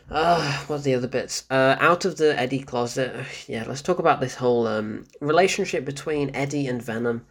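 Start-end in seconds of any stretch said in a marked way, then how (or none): none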